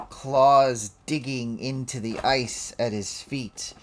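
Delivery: building, slowing game master narration; dramatic scene narration. Acted. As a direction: draw out the dragon's wind-up. Game master describes dragon winding up.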